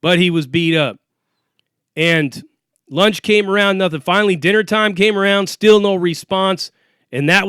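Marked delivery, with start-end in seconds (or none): none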